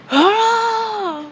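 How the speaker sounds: very high short yell; battle cry. Acted. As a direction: let out a sharp attack shout. Asta slicing icicles.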